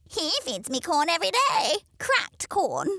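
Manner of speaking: high, loud, smug boast; smug hen. Acted. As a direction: boast it high-pitched and pleased.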